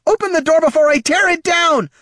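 high, loud frantic shout; frenzied. A narrator's reading. Frantic mother shouting at Auburn, loud frenzied. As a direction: demand it in panic. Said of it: "nearly frenzied".

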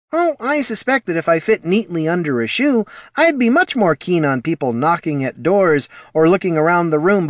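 high-pitched, earnest little scribe; earnest Scribkin character. Acted. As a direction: explain patiently in a high character voice. Voices Poldo defending the gnomes.

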